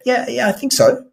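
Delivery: a high, polite, noncommittal "yeah, yeah"; lukewarm yes. Retold or imitated. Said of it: "that's really a polite no".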